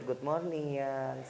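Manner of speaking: low, soft acted endearment; sweet-talking wife. Wife greeting her husband on waking, sweet and low. Acting an affectionate smiling wife.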